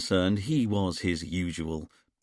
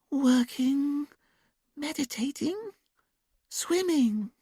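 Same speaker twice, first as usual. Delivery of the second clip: put-on much higher, slow sing-song child voice; childlike sing-song; much slower than usual. A narrator's reading. Julia in trance answering the hypnotist, childlike sing-song. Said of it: "sing-song voice, almost like a child's".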